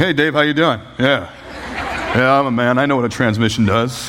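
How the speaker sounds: lightly put-on mechanic-savvy guy; hint of a manly phone persona. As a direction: act like a man who knows transmissions. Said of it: "my Dave transmission voice".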